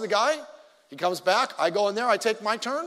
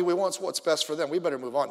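usual speaking voice

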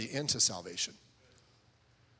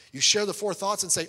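normal talking voice

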